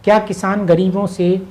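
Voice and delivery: ordinary talk, nothing marked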